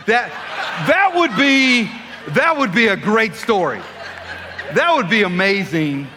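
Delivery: loud protest over laughter; emphatic insistence. Pretend. Preacher defending his made-up story to the audience, loud insistence. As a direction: belt it out as a comic plea.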